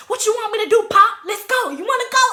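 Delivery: high, loud mock bravado; hammed-up fired-up challenge; pissed, ready to fight. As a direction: replay yourself itching for a fight. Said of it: "I was so pissed at that point".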